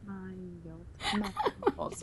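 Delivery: "Miles" very softly stretched into "my-uls"; hushed, drawn-out "Miles"; syllable test. Shown as a demonstration. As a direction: sound out "Miles" slowly and quietly. Testing the syllables slowly.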